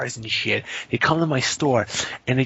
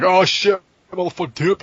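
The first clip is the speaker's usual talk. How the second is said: mock caught thief; 5 semitones higher, 5 dB louder than usual.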